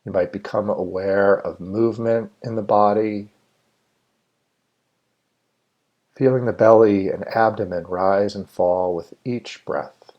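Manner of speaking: measured meditation guide with long, spacious pauses; guided meditation. Real, not acted. Meditation leader inviting listeners to settle and let go, guided meditation. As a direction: lead listeners unhurriedly, phrase by phrase, leaving room to breathe.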